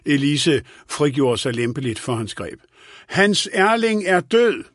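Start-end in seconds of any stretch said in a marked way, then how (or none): 3.1-4.6 high, slow call; mildt råb. A narrator's reading. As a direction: call it out word by word. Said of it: "råbte hun igen". (råbende, højt og langsomt)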